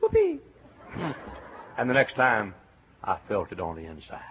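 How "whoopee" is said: whoopee cheer. Retold or imitated. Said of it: "I said".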